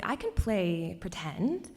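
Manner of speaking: ordinary talk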